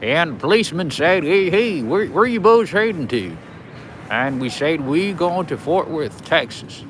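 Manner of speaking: put-on Texan voice with big pitch swoops; Texas accent. Shown as a demonstration. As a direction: act out a Texan story with policeman and travellers. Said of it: "Texas accent. I haven't done it".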